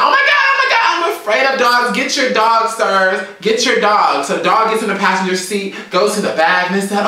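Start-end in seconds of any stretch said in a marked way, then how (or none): 0.0-4.3 high, loud, frantic pleading; panicked shriek. Retold or imitated. Retelling how she begged border officers to call off their dog, high panicked shouting. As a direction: beg the officers to get the big dog off you. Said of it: "I'm freaking out".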